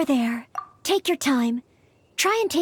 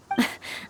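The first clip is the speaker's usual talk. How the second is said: embarrassed giggle; 6 dB quieter than usual. Acted. Embarrassed giggle during her story.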